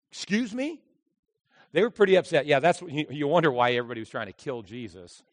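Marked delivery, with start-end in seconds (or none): 0.1-0.8 offended surprise. Pretend. Offended listener voiced.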